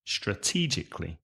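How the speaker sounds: quiet echo of Strategically on TE; stress on TE. Shown as a demonstration. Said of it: "the stress is on the second syllable".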